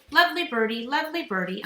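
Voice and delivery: a hint of a high mynah echo; bird voice. A narrator's reading. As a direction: parrot grandma's words. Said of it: "Uncle Feather said".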